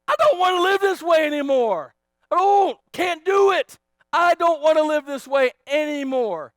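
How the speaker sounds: loud, high cry of someone who's done; preacher acting out a desperate declaration; fed-up outcry. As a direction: cry out at breaking point. Voicing a sufferer's breaking point.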